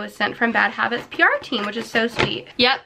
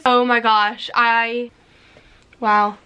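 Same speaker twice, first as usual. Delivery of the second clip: normal talking voice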